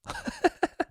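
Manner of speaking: high giggle. Real, not acted. Laughing at his own joke.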